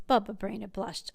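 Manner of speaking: usual speaking voice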